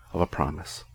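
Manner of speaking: soft, breathy echo of the phrase; whispered echo. Shown as a demonstration. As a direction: let the words fade into a whisper.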